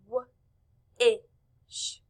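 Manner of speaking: "W-ish" split into wuh, short i, sh; sounded out. Shown as a demonstration. Teacher asking class to listen, sounded out. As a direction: say "W-ish" sound by sound, long pauses between. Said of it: "Let's listen".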